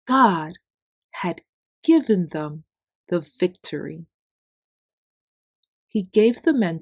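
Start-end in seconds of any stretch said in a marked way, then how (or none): none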